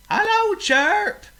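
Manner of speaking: cheerful pig call; pig calling back. Acted. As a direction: shout back through the woods. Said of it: "called Oink back".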